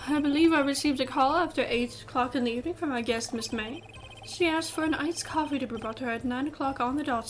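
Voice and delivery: hammed-up English bellboy on the witness stand; horrible English accent. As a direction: put on an over-proper English accent. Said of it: "saying it in this horrible English accent".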